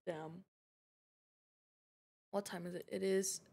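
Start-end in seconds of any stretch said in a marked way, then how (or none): none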